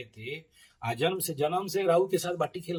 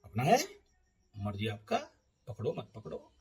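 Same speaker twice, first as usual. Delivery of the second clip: usual speaking voice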